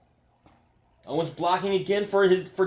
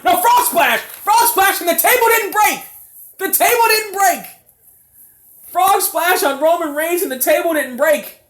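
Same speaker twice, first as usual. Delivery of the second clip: high, loud commentator yell; excited shouting; 9 semitones higher, 6 dB louder than usual. Real, not acted. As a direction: scream the big spot. Frog splash through unbroken table.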